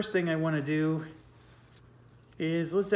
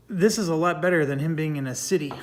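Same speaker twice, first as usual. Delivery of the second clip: normal talking voice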